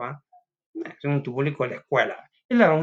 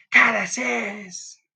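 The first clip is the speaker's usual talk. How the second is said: higher, louder drawn-out demo shout; 8 semitones higher, 5 dB louder, much slower than usual. Shown as a demonstration. Student shows how a question sounds like a shout, higher, louder and slow. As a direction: stretch it out as a shout. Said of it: "es como un grito".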